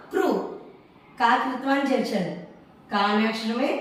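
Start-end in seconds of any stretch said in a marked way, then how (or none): 0.1-0.3 క్రు said short, "kru"; one quick syllable on క్రు; short vowel demo. Shown as a demonstration. Teacher answering how it is pronounced, short క్రు. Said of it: "ఎలా పలుకుతాం".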